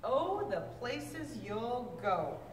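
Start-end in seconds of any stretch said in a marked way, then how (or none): none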